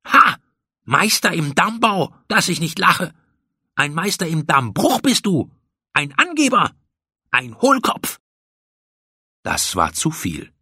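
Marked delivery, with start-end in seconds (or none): 0.0-8.2 high, scornful tirade; mock your friend's boasting in a rage; furious taunting. A narrator's reading. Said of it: "Hochrot vor Wut kam Pauli zurück".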